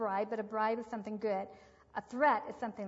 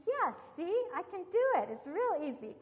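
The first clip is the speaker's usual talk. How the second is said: put-on higher, louder kid voice; proud little boy; 7 semitones higher, 5 dB louder than usual. Aunt retelling Danny showing his hands are free, higher proud kid voice. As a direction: show it off like a proud five-year-old.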